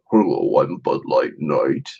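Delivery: a hint of a grave hero; Bud Light Knight. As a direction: announce yourself like a solemn knight. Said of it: "It's like".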